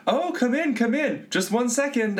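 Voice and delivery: high, bright-pitched welcome to a guest; sing-song welcome at the door; overly sweet hostess inviting a kid in; cheery host greeting. Retold or imitated. Kid imagines host greeting them.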